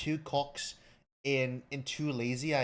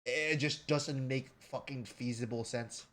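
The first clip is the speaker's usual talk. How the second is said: ordinary delivery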